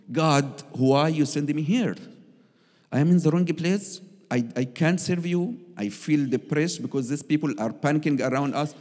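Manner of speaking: high, loud pleading complaint to God; lost and angry, begging God for answers; complaining prayer. Retold or imitated. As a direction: cry out to God in frustration. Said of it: "very complaining, angry".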